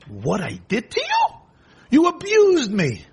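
scoff, then shriek the accusation; indignant rider shouting at his donkey; outraged Bilam. Acted.

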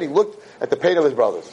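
usual speaking voice

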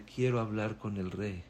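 almost under your breath, timid; hushed plea. Acted. Boy pleading to speak with the king, hushed and soft.

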